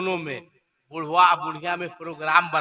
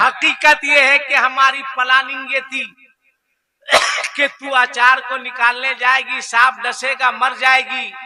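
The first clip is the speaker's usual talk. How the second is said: somewhat higher, louder admission; raised confession; 7 semitones higher, 5 dB louder than usual. Acted. Mother-in-law confessing the plot to her bride. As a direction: blurt out the plot.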